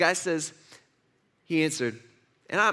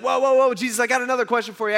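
high, loud whoas, then a quick rush; urgent interruption; 6 semitones higher, 4 dB louder, faster than usual. Acted. Man halting Jesus as he turns to leave, urgently calling. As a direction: call out to stop someone walking away.

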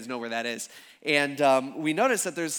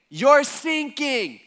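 high, loud, slow yell across the water; shouted warning; 10 semitones higher, 9 dB louder, much slower than usual. Retold or imitated. Strangers on another boat yelling the warning. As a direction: call out the warning slowly over wind.

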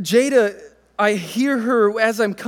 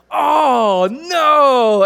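loud, higher mock dismay; acting bummed; 6 semitones higher, 7 dB louder than usual. Dad plays along with hiding daughter, loud mock dismay. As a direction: feign huge disappointment for a child. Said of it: "I kinda act bum".

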